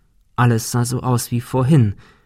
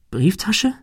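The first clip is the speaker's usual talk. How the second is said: high, questioning echo; bewildered stammer; 8 semitones higher than usual. A narrator's reading. Ben answers the apparition, bewildered and high. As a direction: repeat it in confusion, pitched up. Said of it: "stammelte Ben".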